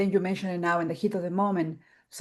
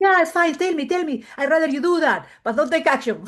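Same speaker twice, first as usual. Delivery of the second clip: imitating her friend; 6 semitones higher, 3 dB louder than usual. Friend's reply voiced in retelling.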